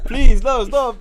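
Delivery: high, loud pleading through laughter; laughing mock plea. Man imitating himself begging the masseuse to stop. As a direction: beg through laughter, hammed-up.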